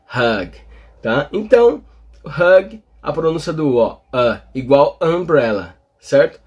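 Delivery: ordinary delivery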